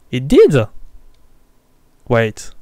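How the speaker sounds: surprised. Real, not acted. Disbelief phone buzzed.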